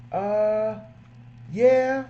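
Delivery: high, unsure little "uh, yeah"; hesitant customer. Retold or imitated. Customer put on the spot, answers high and unsure. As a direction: caught off guard by the upsell.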